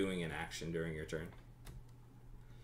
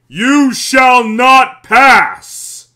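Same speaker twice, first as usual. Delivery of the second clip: huge, slow wizard-like bellow; booming voice; 14 semitones higher, 22 dB louder, much slower than usual. Acted. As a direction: boom it out high and loud, stretching each word. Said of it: "you hear a booming voice".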